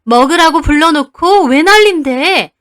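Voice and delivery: loud, indignant aunt; aunt snapping back at mother; angry retort. Retold or imitated. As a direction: fire it back. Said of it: "큰엄마도 일어나서 따지시더라고요".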